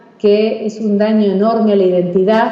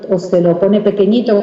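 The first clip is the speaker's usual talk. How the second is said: normal talking voice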